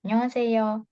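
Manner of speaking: annyeonghaseyo run together smoothly; linked reading. Shown as a demonstration. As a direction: say annyeonghaseyo as one flowing word. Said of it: "Tapi kalau dibaca lanjut".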